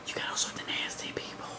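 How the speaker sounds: whisper. Real, not acted. Sudden aside about nasty people.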